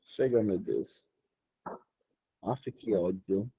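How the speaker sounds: exasperated low mutter under the breath, slow; annoyed mutter. Real, not acted. Teacher grumbling to herself at the screen, exasperated mutter. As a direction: grumble to yourself, fed up.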